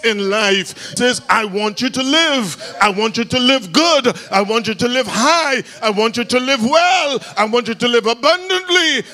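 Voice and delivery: rising, shouted preacher's cry; preaching at full force. Acted. Preacher voicing God's promise to the congregation, building to a shout.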